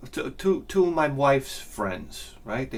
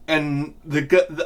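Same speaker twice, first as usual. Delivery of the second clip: normal talking voice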